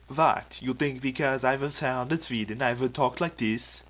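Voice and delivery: loud, indignant mock Swedish accent. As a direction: put on an exaggerated Swedish accent in protest. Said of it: "shouldn't you have a Swedish accent".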